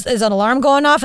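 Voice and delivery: ordinary talk, nothing marked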